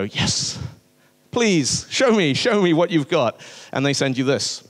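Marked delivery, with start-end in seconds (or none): 0.0-3.4 desperate plea. Retold or imitated. Finally offered a screenshot, desperate.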